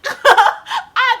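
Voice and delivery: slow, loud laugh-talk; laughing through words. Real, not acted. Retelling sitting there in class, laughing through it. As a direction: giggle through the line.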